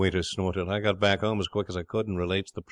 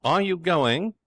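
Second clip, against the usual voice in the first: high, loud, threatening question; impatient demand; 10 semitones higher, 5 dB louder, slower than usual. Acted. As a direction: bellow it to clear the room. Jerry orders everyone out.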